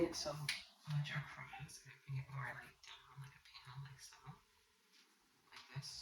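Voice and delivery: whispered under her breath while pinning. Real, not acted. Vlogger pinning a dress, whispering to herself. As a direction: murmur to herself as she works.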